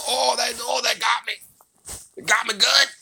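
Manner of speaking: high emotional cry, voice cracking up high; overwhelmed. Real, not acted. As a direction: gasp it out, moved to the core. Overcome reaction to comic moment.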